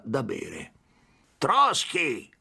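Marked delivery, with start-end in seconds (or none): 1.4-2.3 higher-pitched mocking call; teasing jeer. A narrator's reading. Someone across the bar teasing the consul, mocking call. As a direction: toss out the nickname to needle him. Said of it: "lo prese in giro qualcuno".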